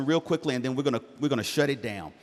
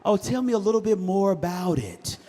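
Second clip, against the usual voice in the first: forced calm dad; 6 semitones higher, 3 dB louder than usual. Retold or imitated. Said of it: "had to do my woo-saw".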